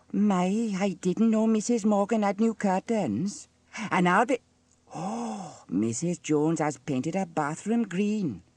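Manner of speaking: high-pitched gossip, gasping with scandal; clucking busybody. A narrator's reading. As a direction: cluck over the neighbours' doings. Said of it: "clucked Dilys".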